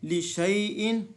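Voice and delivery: slow chanted word; Quranic recitation. Shown as a demonstration. Teacher demonstrating recitation. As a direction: stretch लिशैइन in recitation. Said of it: "जी".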